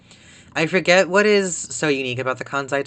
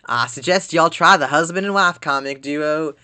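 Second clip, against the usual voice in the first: put-on country accent; 2 semitones higher, 3 dB louder than usual. Streamer reading Kansai dialect articles, in a put-on country accent. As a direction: read it like a country local, y'all and all. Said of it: "more of a country dialect".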